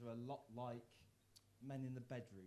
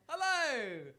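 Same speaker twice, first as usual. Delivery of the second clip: much higher, louder bright hello; cheery stage hello; 12 semitones higher, 14 dB louder than usual. Retold or imitated. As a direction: call it out to the whole pub. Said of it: "and I've gone".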